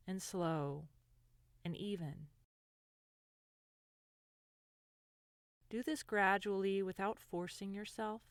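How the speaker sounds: gentle guided meditation voice, lingering between phrases. Acted. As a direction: ease the listener into calm, unhurried.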